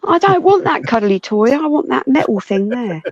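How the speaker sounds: put-on high excited little kid; squeaky kid asking mum; child voice. Acting a kid wanting shovel.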